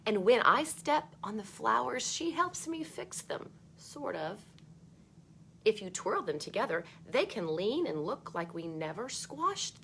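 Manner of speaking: put-on bright read-aloud voice for children; storybook reading. A narrator's reading. Teacher reading a book to kids, lively read-aloud.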